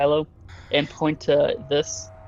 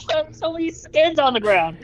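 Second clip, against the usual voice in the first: much higher, astonished; amazed; 13 semitones higher than usual. Real, not acted. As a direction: gasp it out in disbelief. Amazed at the room.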